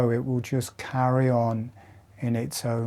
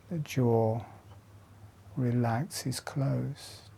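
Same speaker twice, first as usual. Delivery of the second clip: guided meditation. Acted. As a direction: lead them gently through each breath. Guides slow jaw breathing exercise.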